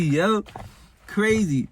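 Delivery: normal talking voice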